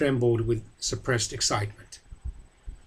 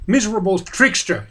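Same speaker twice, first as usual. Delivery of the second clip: loud, high angry yell; shouting; 7 semitones higher, 6 dB louder than usual. A narrator's reading. Boromir yelling after the vanished Frodo. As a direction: spit out the insult. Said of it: "he shouted".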